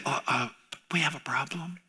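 understated hushed aside; mock calm whisper. Disciples in a mock-calm whisper, politely waking Jesus. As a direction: whisper it like it's no big deal. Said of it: "they didn't say".

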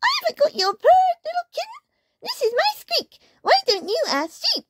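extremely high, squeaky mouse voice; piping cartoon mouse; tiny mouse. A narrator's reading. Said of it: "think of a voice for all of these characters".

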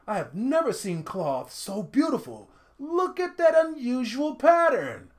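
rising to high excited praise; admiring villager. A narrator's reading. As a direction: gush over the fabric.

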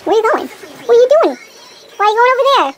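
high, loud mock scolding of the dog; playful pet scolding. Real, not acted. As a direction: tease the dog for wandering off.

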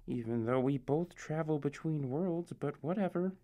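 half under the breath, pride stung; sulky mutter. Acted. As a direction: narrator sulks after being called boring, muttering. Sulky aside after a jab.

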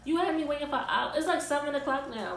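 high, loud, fed-up scolding; angry rant. Retold or imitated. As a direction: tell her off for the hours of waiting. Venting at girl who kept her waiting.

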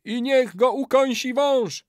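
very high, loud chanting cry; ritual curse. A narrator's reading. Old man calls a snake on betrayer, high wail. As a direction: wail the incantation.

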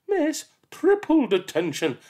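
high, loud clipped verdict; stern teacher. Acted. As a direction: raise the penalty. Teacher escalates.

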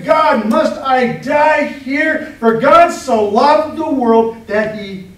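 loud, high, very slowly drawn out cry; mock anguished shout. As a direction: wail it out to God. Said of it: "He's saying".

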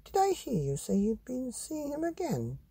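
high, lilting mother's question; curious mum. Acted. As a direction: ask like a puzzled mum.

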